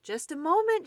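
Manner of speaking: high, loud call through the door; cried out. Acted. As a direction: call out to Mole outside. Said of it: "she cried".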